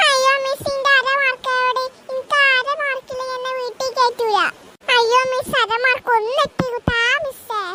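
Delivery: high whining plea for half a mark; whiny pleading child. Acted. Pupil begs the teacher for half a mark, high and whiny. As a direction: beg like a little girl close to tears. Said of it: "ഇവിടെ ഒന്ന് കരഞ്ഞിട്ട് കാര്യമില്ല".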